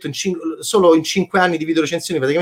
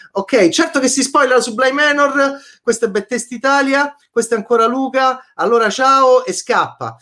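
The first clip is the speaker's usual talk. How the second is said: emphatic; 7 semitones higher, 3 dB louder than usual. Acted. Hammy emphatic sign-off.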